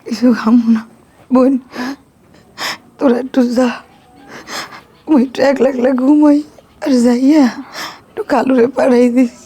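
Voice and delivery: low, weary, tearful voice; worn out from weeping. Acted. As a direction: speak low and haltingly after crying. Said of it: "আপনি কান্দেন না".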